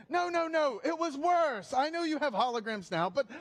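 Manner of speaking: high-pitched, hammed-up granddad; protesting granddad. Granddad insisting Zoom was worse, mock indignant high voice. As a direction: protest with mock-dramatic urgency.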